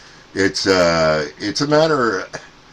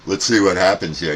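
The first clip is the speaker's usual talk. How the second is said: ordinary delivery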